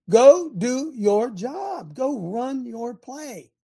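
high, slow emphatic orders; exasperated coach. Acted. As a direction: drag out each word of the command. Acted coach orders player back.

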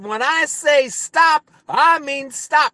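slow, high, threatening cartoon growl; cartoon cowboy warning his horse, slow and high; Yosemite Sam. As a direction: lay down the law like a cartoon cowboy. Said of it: "and he said".

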